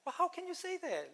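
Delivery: high, strained voice of disbelief; a disciple balking at the impossible; incredulous. Retold or imitated. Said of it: "he's saying".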